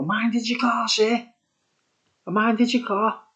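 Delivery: put-on high, eager little boy's voice; a child angling for his tip; eager kid. Kid greeting the driver leaving the pub, high and eager.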